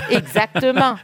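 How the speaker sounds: laughs through the word; real laugh of agreement, high; laughing yes, exactly. Real, not acted.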